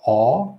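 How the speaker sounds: aw held, wide and round; jaw-dropped AW. Shown as a demonstration. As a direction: make a round mouth for aw. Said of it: "dropping your jaw all the way down".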